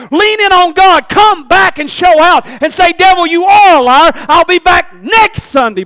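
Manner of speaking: loud, high preaching build; full-force sermon climax; fiery preaching. Acted. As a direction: preach it hard to the church.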